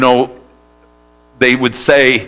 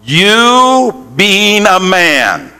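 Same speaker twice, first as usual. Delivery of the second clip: slow shouted call; 3 semitones higher, much slower than usual. Shown as a demonstration. Preacher leading the congregation to repeat, shouting. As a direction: proclaim "You being a man" stretched out. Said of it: "Say that".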